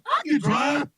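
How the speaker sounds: normal talking voice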